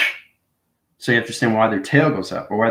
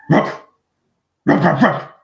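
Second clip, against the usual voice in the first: higher, louder mock dog bark; 7 semitones higher, 4 dB louder than usual. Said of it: "through bark".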